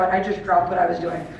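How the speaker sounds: ordinary delivery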